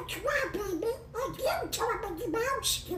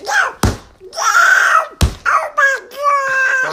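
screaming no, much louder and higher; panicked scream; 5 semitones higher, 17 dB louder, slower than usual. Acted. Donald attacked by Mickey, panicked screaming. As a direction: shriek as if being attacked.

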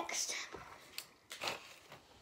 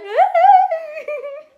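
loud burst of laughter; 13 semitones higher, 13 dB louder than usual. Real, not acted. Big laugh after startle.

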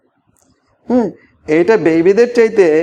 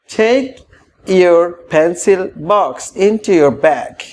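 tie your tie stretched out very slowly; slow model phrase; much slower than usual. Shown as a demonstration. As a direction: draw out each word for learners. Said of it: "কি বলবে?".

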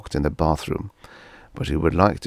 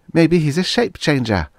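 put-on high girl voice, a touch of glee; gleeful girl; 10 semitones higher, 4 dB louder than usual. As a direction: savor the dark idea like a girl. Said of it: "in a burst of evil fantasy".

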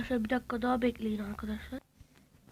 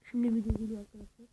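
ordinary delivery

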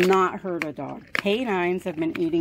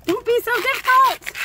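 much higher, louder mock scold; squeaky scolding of the stubborn dog; high-pitched scolding; 5 dB louder than usual. Real, not acted.

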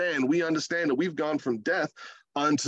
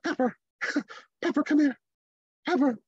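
higher, quick, giggly "Pepper" with no authority; father mimicking his son calling the Yorkie; half-hearted call; 10 semitones higher than usual. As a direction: call the dog like you don't expect her to come. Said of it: "he's like".

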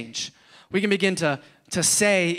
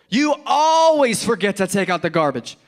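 "always" stretched long and loud; accusing; 3 semitones higher, 4 dB louder than usual. Acted. Spouse complaining about the garbage, stretching "always". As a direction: lean hard on "always" as an exaggerated complaint.

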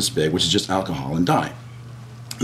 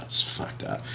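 unvoiced whisper, much softer; whispered thought; 10 dB quieter than usual. Walking to the boss's office, thinking it in a whisper. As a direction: murmur the thought to yourself.

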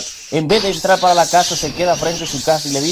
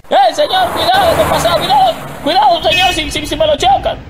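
much higher, louder shout of alarm; warning shout; 13 semitones higher, 4 dB louder than usual. Acted. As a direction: yell at him to watch the cars. Vecino grita advertencia en la calle.

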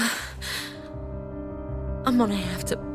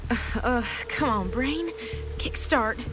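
dazed straining; 6 semitones higher, 4 dB quieter than usual. Acted. Dazed, urging her brain to work.

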